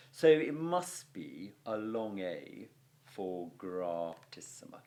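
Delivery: ordinary delivery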